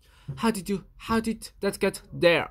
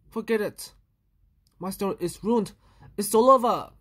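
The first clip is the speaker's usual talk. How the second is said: ordinary delivery, nothing marked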